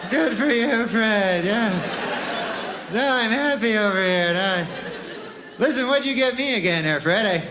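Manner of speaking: jealous friend faking happiness; forced cheer. Acted. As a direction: bravely pretend to be glad.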